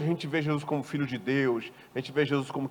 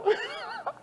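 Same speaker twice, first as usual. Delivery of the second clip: high laugh; 6 dB quieter than usual. Real, not acted. Laugh after bully cousin joke.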